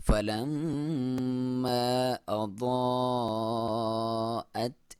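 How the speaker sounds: slow فلما أضاءت with the optional madd kept short; shortened madd demo. Shown as a demonstration. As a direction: recite أضاءت without the long stretch. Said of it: "مد جائز کو کسر کیا".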